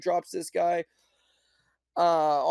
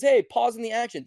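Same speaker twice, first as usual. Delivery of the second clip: louder, slightly higher ref command; mock ref call; 7 semitones higher, 6 dB louder than usual. Ref stopping the fight, louder mock command. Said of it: "Ref says".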